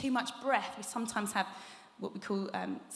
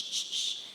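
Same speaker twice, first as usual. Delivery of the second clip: shh demo; 3 dB quieter than usual. Shown as a demonstration. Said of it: "with ft and shh".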